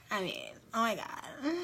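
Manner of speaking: vocal fry demo. Shown as a demonstration. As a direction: crackle the words in a quiet fry. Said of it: "We talk on a vocal fry".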